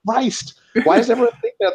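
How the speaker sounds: high, whiny exasperated complaint; lightly voiced, put-upon orc actor; exasperated Ito. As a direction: whine it up high in mock outrage. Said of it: "He's like".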